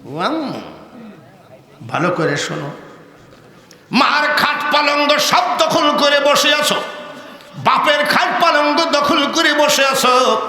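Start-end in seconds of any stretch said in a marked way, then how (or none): 0.1-0.6 mock-sweet soft calling; imitating fawning son-in-law. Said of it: "শ্বশুর শাশুড়িকে কয়". 3.9-10.4 high, loud accusing sermon delivery; full-force reproachful preaching; impassioned preaching. Acted.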